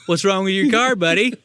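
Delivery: high, loud gruff mechanic; mock mechanic. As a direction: call out to a customer, hammed up. Mechanic greeting customer.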